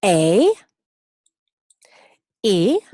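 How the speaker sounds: "A, E" loud and drawn out as English letter names; vocal modelo para repetir. Shown as a demonstration. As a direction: model "A" as ay and "E" as ee, loudly. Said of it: "Vamos a hacerlo juntos". ("A, E" en inglés, fuerte para repetir)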